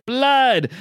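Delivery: high, loud hammed-up cry; mock monster shout; vampire roar. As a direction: bellow it like a hungry vampire.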